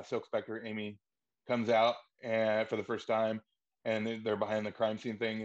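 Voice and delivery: usual speaking voice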